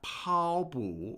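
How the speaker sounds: slow 跑步 with 跑 held high instead of dipping; wrong tones demonstration. Shown as a demonstration. Teacher warning learners off a mistake, 跑 high and 步 drawn out. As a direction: stretch 跑 up high, let 步 sag without a sharp fall. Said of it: "It's not".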